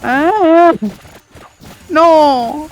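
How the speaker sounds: high, loud cry of alarm; mock scared. Acting scared after playful threat.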